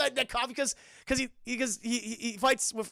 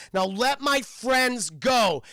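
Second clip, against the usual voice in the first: loud, higher, drawn-out acted demand; demanding shout; 5 semitones higher, 8 dB louder, much slower than usual. Aang orders the king to free his friends, demanding shout. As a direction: call it out slowly and firmly.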